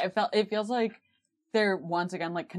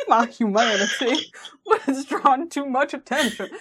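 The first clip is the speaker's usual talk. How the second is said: Dean, a little higher and louder; a put-on Dean read; Dean impression; 6 semitones higher, 6 dB louder than usual. Retrying Dean's line in a put-on voice. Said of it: "should Dean be Benoit Blanc".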